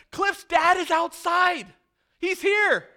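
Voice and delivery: loud, high, breathless news at the door; slow, loud, high cry of joy; excited announcement. Pretend. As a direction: burst in shouting the good news. Someone runs in with news.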